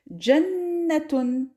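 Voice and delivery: Jannatun stretched out slowly; slow Arabic word. Shown as a demonstration. Teacher models the last word slowly. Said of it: "on va faire un dernier mot".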